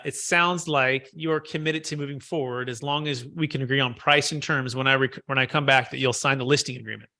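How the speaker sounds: statement falling to a close on "agreement"; downward inflection. Shown as a demonstration. Agent closing a client on the listing, downward inflection on "agreement". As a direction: let "sign the listing agreement" settle downward, no question. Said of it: "it's a downward inflection in my voice".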